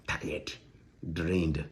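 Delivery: drained whisper of tired; worn-out whisper. Real, not acted. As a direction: let the word fade to a breath. Describing how drained he felt.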